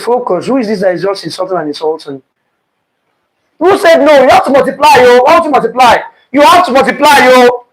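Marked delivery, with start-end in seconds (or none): 3.6-6.0 louder and higher, pressing the point on the class; emphatic insisting. Real, not acted. Teacher answering a student who said no, loud and insistent. 6.3-7.3 higher and louder, stress on have; loud insistence. Real, not acted. Teacher correcting the class, loud and insistent. 7.3-7.7 louder and higher, pressing the point on the class; emphatic insisting. Real, not acted. Teacher answering a student who said no, loud and insistent.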